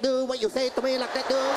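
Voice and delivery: imitated rapid-fire Vietnamese accent; caricatured Vietnamese-accented patter. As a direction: rattle it off in a mock Vietnamese accent. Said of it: "You know they talk that fast".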